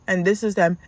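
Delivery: ordinary talk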